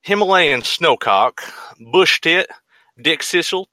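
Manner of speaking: hammy mock Southern drawl; southern accent. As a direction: put on a bad Southern accent. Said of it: "in, like, a shitty southern accent".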